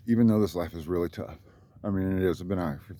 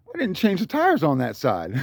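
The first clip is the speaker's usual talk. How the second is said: higher, louder laughing realization; 8 semitones higher, 4 dB louder than usual. Real, not acted. Realizing his mistake, laughing. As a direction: laugh as it dawns on you.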